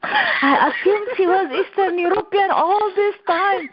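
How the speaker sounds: high, laughing mock boss voice; hammed-up surprised boss with a laugh; laughing impression. Retelling the boss's surprise.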